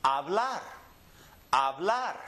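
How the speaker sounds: Hablar as a-BLAR, silent H; last-syllable stress. Shown as a demonstration. Teacher demonstrates words ending in R, Hablar stressed on BLAR. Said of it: "we're gonna go up on the last syllable".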